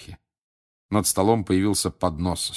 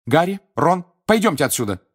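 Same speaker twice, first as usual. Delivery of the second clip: calling out; 10 semitones higher, 6 dB louder than usual. A narrator's reading. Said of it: "позвала Гермиона".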